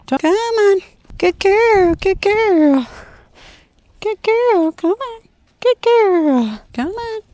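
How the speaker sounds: bright, high sing-song coaxing for a puppy; high puppy-calling voice. Real, not acted. Trainer calling her puppy in a high, coaxing voice. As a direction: coax the puppy along in a high, cheerful voice.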